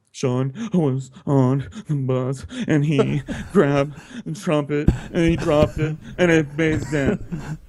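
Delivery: hammed-up upset child, word by word; tearful kid tattling. Storyteller as upset kid blaming Sean, tearful.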